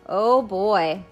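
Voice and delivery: ordinary talk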